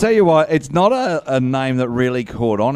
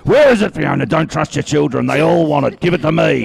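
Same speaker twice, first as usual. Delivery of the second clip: high, raised interrogating voice; acted pleading, louder toward the end; mock urgent demand; 4 semitones higher, 6 dB louder than usual. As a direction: press hard for an answer. Acting out demands for the money.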